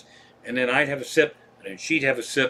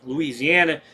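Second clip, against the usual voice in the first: usual speaking voice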